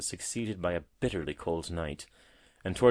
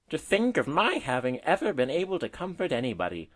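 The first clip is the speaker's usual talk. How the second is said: higher-pitched, amazed exclamation; exclaiming; 7 semitones higher, 5 dB louder than usual. A narrator's reading. Woodlark marvelling at having comforted anybody, exclaiming. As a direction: exclaim it in wonder at yourself. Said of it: "ejaculated the woodlark".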